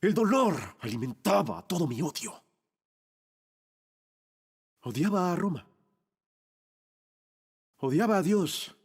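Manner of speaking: low, dark confession in separate phrases; brooding hatred. Acted. Dying thief at the edge of hell, low brooding. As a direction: sink low and dwell on the hate.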